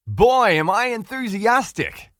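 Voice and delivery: put-on high, loud, slow shout of boy am I enthusiastic; screamed enthusiasm. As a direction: scream it like a crowd forced into excitement. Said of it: "They were screaming".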